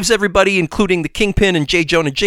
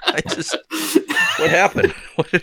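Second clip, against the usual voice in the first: laughing; 3 semitones higher than usual. Real, not acted. Telling his co-host he rushed the recap, laughing. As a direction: giggle out the confession.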